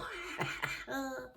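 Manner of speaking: short high laugh at the joke. Real, not acted. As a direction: laugh at the sticker. Laughing at a sticker.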